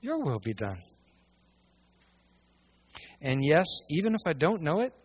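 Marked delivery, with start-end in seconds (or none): 0.0-0.8 quiet prayer. Shown as a demonstration. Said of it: "our prayer must always be".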